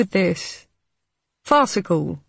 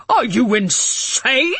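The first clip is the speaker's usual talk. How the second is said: angry; 6 semitones higher, 3 dB louder, slower than usual. A narrator's reading. Harding furious at the will.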